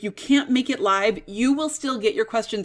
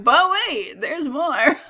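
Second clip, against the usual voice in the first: infomercial voice; 4 semitones higher, slower than usual. Acted. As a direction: play the cheesy infomercial pitch. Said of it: "sound like a cheesy infomercial".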